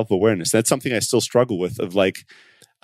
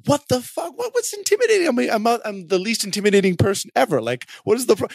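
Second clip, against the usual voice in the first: high-pitched, incredulous retold outburst; outraged disbelief; 11 semitones higher than usual. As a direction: replay your shocked outrage, way up high. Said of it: "outraged by that".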